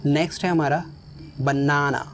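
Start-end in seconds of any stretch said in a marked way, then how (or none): 1.4-2.0 "banana" with a long, stressed first "ना"; ba-NAA-na for "banana"; stress on middle syllable. Shown as a demonstration. Teacher models "banana", stress on the middle "ना". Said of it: "बीच में stress होगा".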